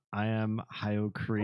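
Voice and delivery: usual speaking voice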